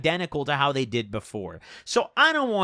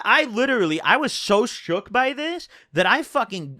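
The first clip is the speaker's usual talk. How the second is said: usual speaking voice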